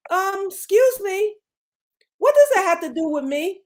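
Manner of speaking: put-on sassy disbelief; mock indignant. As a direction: act out an affronted comeback to someone's excuse. Mock reply to angry people.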